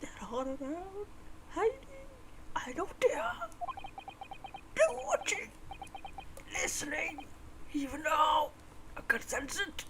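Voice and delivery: hushed, fearful muttering in broken phrases; quietly sense hidden watchers, voice rising high; paranoid prisoner. Acted.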